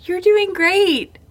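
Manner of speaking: mother rushing over to praise a nursing stranger, high and sweet; sweet encouragement. Retold or imitated. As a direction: gush kindly to a stranger nursing her baby. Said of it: "while I walk across the restaurant to be like".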